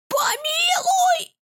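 shrill, drawn-out witch shriek, very high; unnatural witch squeal. Acted. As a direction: screech for mercy like a hag. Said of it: "взвизгнула ведьма совсем неестественным голосом". (неестественный визг ведьмы)

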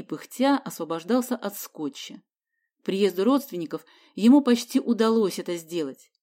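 plain reading voice

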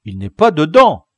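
loud schoolboy report; loud declaration. A narrator's reading. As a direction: call out the bad news. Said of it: "déclara Tougueul".

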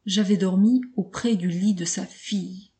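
usual speaking voice

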